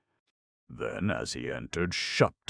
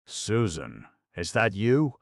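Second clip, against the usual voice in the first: hoarsely appealing; 5 semitones higher, 4 dB louder than usual. Acted. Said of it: "hoarsely appealing".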